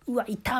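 high startled yelp of pain; mock ouch. The comedian acting out bumping a wall, high mock yelp. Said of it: "みたいなことをやったら".

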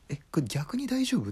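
sudden high, loud worried question; startled doubt. Retold or imitated. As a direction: blurt it out, half alarmed. Doubting the big laughs.

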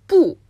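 不 said with a sharp falling fourth tone; Chinese fourth tone. Shown as a demonstration. Host demonstrating Mandarin tone to a viewer, falling. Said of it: "これは第四声ですよね".

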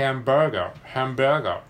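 "ハンバーガー" with first vowel between a and e; English ae vowel. Shown as a demonstration. Learner practising the difficult vowel in "ハンバーガー", loud and careful. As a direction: punch "ハンバーガー" loudly with an English hae opening. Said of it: "絵の形であを言うみたいな".

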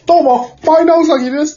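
high, bright mascot greeting; mascot character voice. Pretend. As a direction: play a cute rabbit mascot. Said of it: "って言ってやるんでしょ？".

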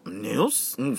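usual speaking voice

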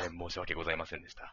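solemn put-on good voice. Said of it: "いい声を作って".

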